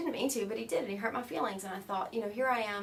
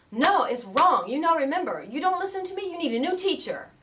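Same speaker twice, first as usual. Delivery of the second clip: loud, high, insistent teacher rebuke; scolding yogi; 8 semitones higher, 11 dB louder than usual. Retold or imitated. Yogi berating his forgetful student, loud scolding. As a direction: scold the pupil at full volume.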